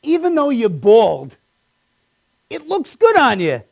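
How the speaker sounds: high, mildly cheery backhanded compliment; a hint of a chummy manager; lightly voiced coworker. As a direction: lightly mimic the boss's fake sincerity. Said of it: "He goes".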